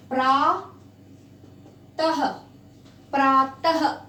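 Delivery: "प्रातः" ending in a breathy final ah; visarga demo. Shown as a demonstration. Teacher showing pupils the visarga in "प्रातः". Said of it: "प्रात नहीं बोलते हैं उसको".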